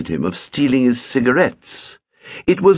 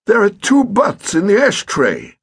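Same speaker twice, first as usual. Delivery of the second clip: put-on irritable elderly man, pitched up; fussy stepfather scolding; testy old gentleman; 12 semitones higher, 4 dB louder than usual. Pett accuses.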